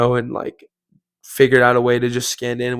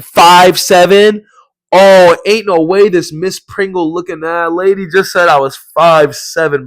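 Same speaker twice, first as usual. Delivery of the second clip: loud, drawn-out, higher-pitched incredulous echo; indignant disbelief; 4 semitones higher, 6 dB louder, slower than usual. Retold or imitated. Member overhearing his height described, indignant disbelief. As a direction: blurt it out as if badly insulted. Acted outrage at insulted height.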